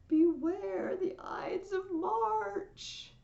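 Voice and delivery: high, very slow wailed warning; long, high ominous call; soothsayer's cry. Acted. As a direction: draw out each word as a loud prophecy. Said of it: "But the soothsayer yells out".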